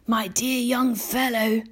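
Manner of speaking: put-on high, slow, kindly old grasshopper; gentle. A narrator's reading. Old grasshopper speaks to young James, gentle. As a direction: address him tenderly, taking your time. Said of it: "said gently".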